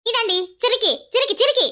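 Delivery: high-pitched, repeated insult; angry taunting. Acted. Woman insulting her in-law in a quarrel, angry and taunting. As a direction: fling the name in her face.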